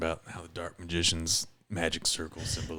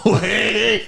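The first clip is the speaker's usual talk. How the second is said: laughing; 11 dB louder than usual. Real, not acted. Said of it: "laughter heard".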